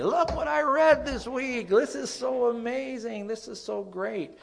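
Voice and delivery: very high, gushing with excitement; excited high voice. Pretend. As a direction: gush like a thrilled kid. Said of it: "it's like, "Oh".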